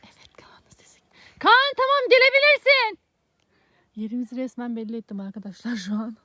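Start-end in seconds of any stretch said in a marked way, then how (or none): 0.0-1.0 whispering while hiding. Real, not acted. 1.4-3.0 high loud call to the seeker. Real, not acted. Calling seeker after hiding.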